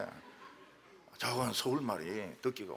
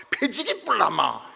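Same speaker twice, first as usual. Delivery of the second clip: slow, louder mock Gyeongsang threat, laughing through it; Gyeongsang dialect threat; 7 semitones higher, 8 dB louder, slower than usual. Pastor imitating Gyeongsang elders threatening in Gyeongsang dialect, laughing. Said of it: "경상도 말로".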